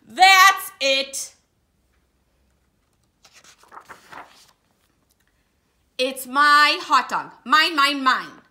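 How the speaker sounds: loud tantrum shout of mine; furious yell. Acted. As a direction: explode like a greedy bird. Pigeon explodes over hot dog.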